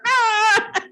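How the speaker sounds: loud, high imitated outcry; shocked cry. Swami reacting to her stuck-out tongue, imitated cry. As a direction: mimic a comically aghast yell.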